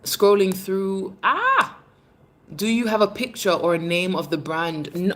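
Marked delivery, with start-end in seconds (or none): 1.2-1.8 a high, sudden "Ah!" of surprise; sharp high exclamation of surprise. Real, not acted. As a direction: gasp out a startled ah. Spots something while scrolling.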